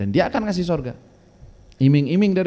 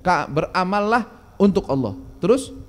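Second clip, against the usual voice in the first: ordinary talk, nothing marked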